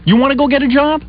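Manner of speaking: high, incredulous challenge; sarcastic high-pitched questioning; challenging. Retold or imitated. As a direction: confront the wife, voice pushed up high. Retold challenge to his wife.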